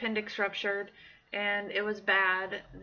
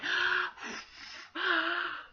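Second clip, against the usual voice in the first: demonstrated calming breaths; 9 semitones higher than usual. Retold or imitated. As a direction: lead someone through slow breathing. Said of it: "breathe with me".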